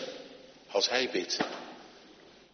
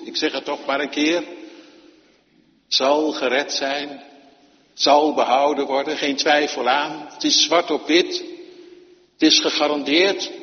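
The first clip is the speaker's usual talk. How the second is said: loud, high, insistent preaching; fervent preacher pressing the promise; preaching at full force; 4 semitones higher, 6 dB louder than usual. Real, not acted. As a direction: hammer home the certainty.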